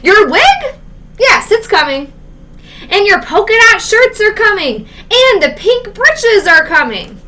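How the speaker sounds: high, loud bellowing announcement; shouting. Acted. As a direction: holler the news at the whole street. Paul yelling deliveries.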